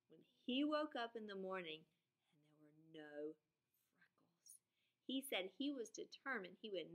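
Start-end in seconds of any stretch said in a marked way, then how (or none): 1.8-4.5 a hushed, dramatic whisper; suspense whisper. Acted. Teacher building suspense for her class, whispering. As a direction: drop to a whisper for the reveal. Suspense reveal in story recap.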